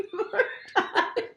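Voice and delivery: talks through real laughter; can't stop laughing while speaking. Real, not acted. Laughing at client's face.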